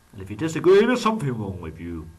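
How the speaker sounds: half-joking loud threat to viewers; mock threat.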